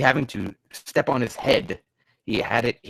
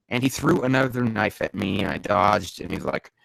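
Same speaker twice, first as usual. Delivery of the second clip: normal talking voice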